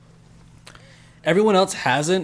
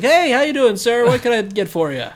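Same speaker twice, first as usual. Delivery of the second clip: high, loud, bright service greeting; eager waiter voice; 8 semitones higher, 4 dB louder than usual. Retold or imitated. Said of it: "You should be like".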